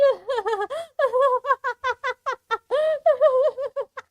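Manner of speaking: long, high villain cackle; maniacal laugh. Acted. Monster Claire delighted by killing, maniacal laughter.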